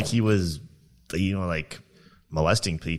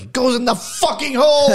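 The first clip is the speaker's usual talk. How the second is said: high, loud exasperated yell; yelling at new kid; 8 dB louder than usual. Retold or imitated. Coworker yelling at the new kid in the crane, furious-sounding shout. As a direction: bellow it, pitch jumping way up. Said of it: "I was yelling at".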